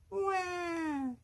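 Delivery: put-on high, drawn-out baby "wah"; sustained high-pitched mock baby cry; baby wail. A narrator's reading.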